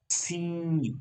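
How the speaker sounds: સી with a long hissed s gliding into a high, held ee; air into ee. Shown as a demonstration. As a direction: release air, then sustain ee in સી. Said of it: "હવા કાઢો ને ઈનો અવાજ આવશે".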